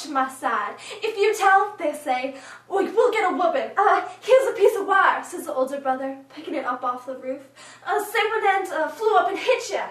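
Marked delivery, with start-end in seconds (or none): 1.0-1.8 loud, higher urgent warning; panicky brother. Acted. Brothers warning hurt sister against telling, panicky. 2.7-5.2 loud, raised, stumbling urgency; panicky brother. Acted. Brother inventing a lie for his sister, panicky. 7.9-9.9 loud, higher, hesitant improvising; panicky brother. Acted. Older brother coaching sister in a lie, panicky.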